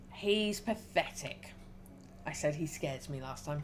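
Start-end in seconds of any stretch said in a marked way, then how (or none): 0.1-1.5 drawn out, slow and scornful; slow dismissive line. Shown as a demonstration. Player reads the chosen dialogue option, slow and scornful. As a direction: let each word drag with contempt. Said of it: "so he's pathetic this time".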